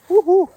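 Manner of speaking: high loud woohoo of delight; joyful woohoo. Real, not acted. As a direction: whoop with glee. Joyful whoop.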